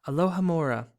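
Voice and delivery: ordinary delivery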